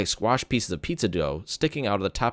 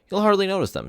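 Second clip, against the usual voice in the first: cheerful; 11 semitones higher, 3 dB louder than usual. A narrator's reading. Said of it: "trying to be cheerful".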